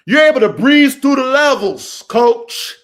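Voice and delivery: big motivational yell; emphatic shout. Real, not acted. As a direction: shout it to drive the point home. Repeats the point at full volume.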